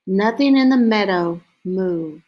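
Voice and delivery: usual speaking voice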